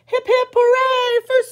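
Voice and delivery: bright cheer, high and ringing; a happy chant for the hero. Acted. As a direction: cheer him on like the crowd.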